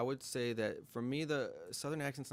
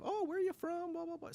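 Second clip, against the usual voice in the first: high-pitched, chatty cashier; high, sociable mock greeting; super talkative clerk. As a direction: imitate an eager small-talker. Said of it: "super talkative".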